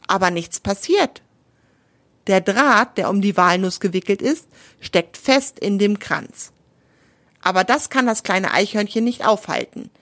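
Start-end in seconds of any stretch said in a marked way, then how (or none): none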